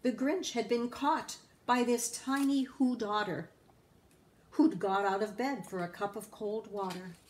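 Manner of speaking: plain reading voice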